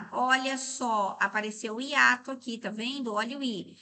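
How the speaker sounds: normal talking voice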